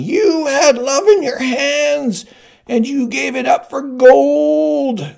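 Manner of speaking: put-on very high, loud, drawn-out old-woman yell. Old woman yelling at Buttercup before the crowd, very high and slow. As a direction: scream it at the queen like an accusing crone. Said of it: "and suddenly she was yelling".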